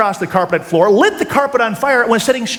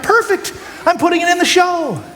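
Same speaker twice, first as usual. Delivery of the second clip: excited thought; 9 semitones higher than usual. Retold or imitated. Teen juggler decides the fire trick goes in the show, thrilled shout. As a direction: cheer yourself on as if you just nailed it.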